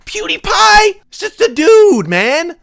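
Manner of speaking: real disbelief at fans; incredulous shout. Real, not acted. Incredulous rant at viewers.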